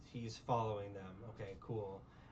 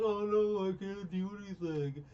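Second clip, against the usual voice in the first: higher and louder, a hammed-up helpless wail; mock damsel in distress; 6 semitones higher, 4 dB louder than usual. Retelling the heroine's fall to friends, hammed-up helpless cry. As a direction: play her as an overdone helpless heroine. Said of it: "She's like".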